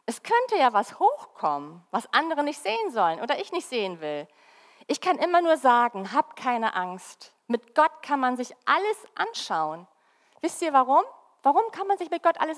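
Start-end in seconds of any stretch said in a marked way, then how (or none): none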